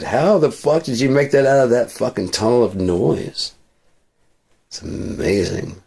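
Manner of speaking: ordinary talk, nothing marked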